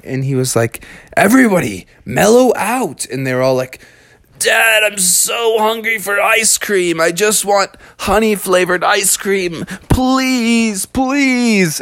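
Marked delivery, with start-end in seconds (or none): 1.2-3.1 higher-pitched call to settle down; exasperated dad. A narrator's reading. Father Bear to whiny kids, raised exasperated call. 4.4-11.8 put-on high, pleading child voice; whiny kids. A narrator's reading. Kids begging Dad for ice cream, high whining. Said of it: "everyone was getting so whiny".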